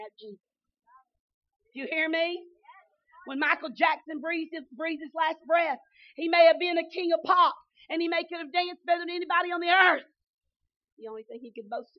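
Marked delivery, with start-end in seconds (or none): 1.7-10.1 louder, higher preaching; rousing preaching. Acted. Preacher calls out to the congregation about [name], rousing. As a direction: rouse the congregation.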